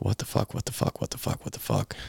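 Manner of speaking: hushed, repeated whisper of panic; stunned whisper. Retold or imitated. Just cut from the team, whispered panic. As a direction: whisper it over and over in shock. Panicked after being released.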